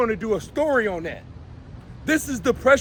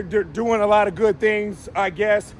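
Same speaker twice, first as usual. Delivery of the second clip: ordinary talk, nothing marked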